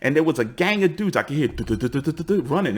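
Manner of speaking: usual speaking voice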